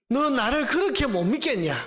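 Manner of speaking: high, insistent reproach; God. Acted. God challenging the doubting prophet, high insistent reproach. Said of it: "하나님께서 이 하박국에 주신 대답이".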